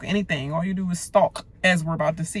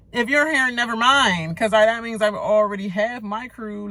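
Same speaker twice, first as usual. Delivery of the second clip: animated mock pitch; 5 semitones higher, 4 dB louder than usual. Voicing houseguest pitch.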